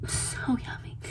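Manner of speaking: breathy whispered echo of so yummy; swooning whisper. Retold or imitated. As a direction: whisper it like a delicious secret. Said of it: "whispered repeat of so yummy".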